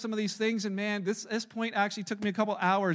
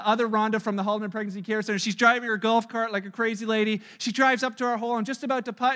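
usual speaking voice

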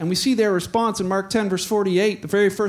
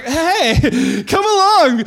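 high, loud, laughing call; sudden friendliness; 7 semitones higher, 6 dB louder, much slower than usual. Acted. Crowd now invites Bart along, hammed-up friendly. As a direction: switch to over-friendly beckoning.

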